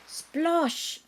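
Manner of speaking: loud, high splosh sound; splash. A narrator's reading. The thieves fall into the pond, loud splash. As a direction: make the big splash.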